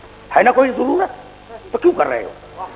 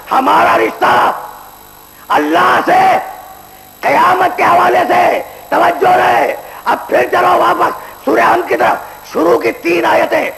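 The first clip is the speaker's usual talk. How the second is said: full-force preaching; 8 semitones higher, slower than usual. Acted. Preacher warns the young men about judgement day, in full-force preaching. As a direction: thunder it from the pulpit. Preacher stressing point, raised voice.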